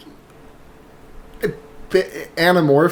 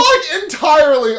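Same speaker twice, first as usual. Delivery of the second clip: laughing shout; 9 semitones higher, 4 dB louder, slower than usual. Real, not acted. Mock outrage while laughing.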